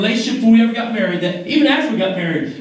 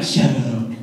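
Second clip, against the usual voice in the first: much lower, bashful brush-off; mock dismissal; 14 semitones lower than usual. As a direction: toss it off shyly. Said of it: "she's like".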